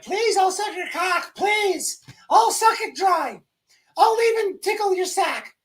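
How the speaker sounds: high falsetto begging shout; mock begging woman. Mocking her pleading with the arresting officer, shrill falsetto begging. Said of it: "and she's like".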